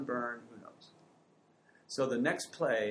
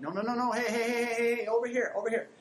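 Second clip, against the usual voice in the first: put-on high, loud child calling hey hey; kid calling for attention; 11 semitones higher, 6 dB louder than usual. Child demanding the parent's attention, high loud calls. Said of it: "And they're like".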